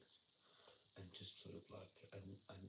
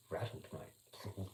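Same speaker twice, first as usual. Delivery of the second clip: slow, teasing "Rattled, mate"; drawn-out rattled; 10 dB louder, much slower than usual. Shown as a demonstration. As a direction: stretch it out very slowly, savouring. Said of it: "Just rattled, mate".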